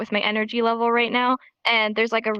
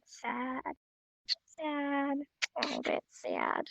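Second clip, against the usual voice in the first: hushed, fading sad demonstration; 13 dB quieter, slower than usual. Shown as a demonstration. Teacher showing a little bit sad, very quietly. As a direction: shrink the voice into sadness. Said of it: "your mind starts going and you get".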